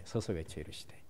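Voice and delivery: low, very soft aside; quiet repeat. Real, not acted. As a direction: drop right down and repeat it under the voice. Low repeat after loud proclamation. (낮고 아주 작게 되뇌듯)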